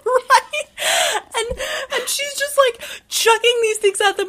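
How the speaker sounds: talking through laughter; laughing. Real, not acted. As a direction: laugh while telling it. Laughing while describing.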